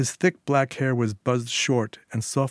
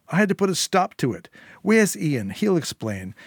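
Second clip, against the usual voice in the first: higher, anxious pleading; desperate henchwoman; 6 semitones higher, 3 dB louder than usual. A narrator's reading. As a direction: justify yourself fast, scared of him. Syl pleads with Eddie.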